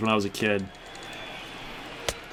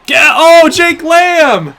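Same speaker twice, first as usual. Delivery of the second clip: a big, slow, high shout of joy; excited home run call; 11 dB louder, much slower than usual. Real, not acted. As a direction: cheer the homer with a huge drawn-out roar.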